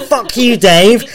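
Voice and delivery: retorting to the coworker, a mock yell. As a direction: fire it back up the stairs. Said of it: "I went".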